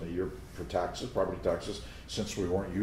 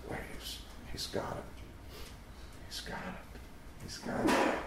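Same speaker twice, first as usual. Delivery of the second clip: breathy unvoiced whisper, much softer; 9 dB quieter, slower than usual. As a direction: whisper it like giggly twelve-year-olds. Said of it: "laughing and whispering like twelve-year-olds".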